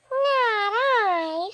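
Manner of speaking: high, drawn-out snooty cat; snooty bored cat. Acted. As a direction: turn her nose up like a bored cat. Cat refuses.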